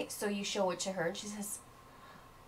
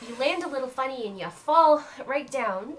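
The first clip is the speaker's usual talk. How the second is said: ordinary talk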